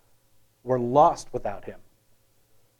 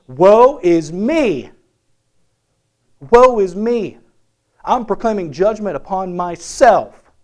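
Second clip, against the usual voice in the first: slow, loud, higher wail of woe; loud anguished cry; 4 semitones higher, 8 dB louder, slower than usual. Retold or imitated. Preacher acting Isaiah crushed in God's presence, loud wail. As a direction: cry it out as a man undone before God.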